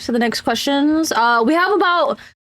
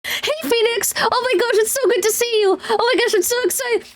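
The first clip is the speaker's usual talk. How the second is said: much higher, gushing hello that speeds up at the end; quick switch into an overjoyed friend greeting Phoenix; bubbly excited greeting; 9 semitones higher, faster than usual. Shown as a demonstration. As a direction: squeal over seeing a friend again. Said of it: "I'll go from, like".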